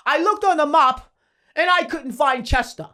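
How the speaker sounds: loud, high mock French accent; French-accented boast, loud and deliberate; imitated French accent. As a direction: taunt in a put-on French accent. Mimicking the French opponent's taunt.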